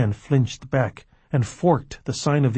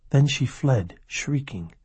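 plain reading voice

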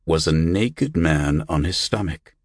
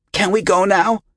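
put-on high, impatient child's voice; urging boy; 13 semitones higher, 4 dB louder than usual. A narrator's reading. Boy eager to race back to the beach, urging. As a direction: urge him along, pitched way up. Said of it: "Yonkel urged".

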